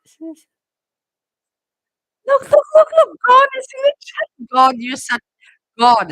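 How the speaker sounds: high, loud panicked yelling; frantic shouting. Real, not acted. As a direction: yell over the chat in a panic. Frantic outburst at the chat.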